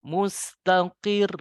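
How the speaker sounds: mustaqir slow, rising on the doubled ra. Shown as a demonstration. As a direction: lift mustaqir at the end, slowly. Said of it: "Nah, dinaikkan".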